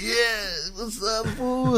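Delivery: high, slow taunting voice; mocking robber. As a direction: taunt like a cocky thief.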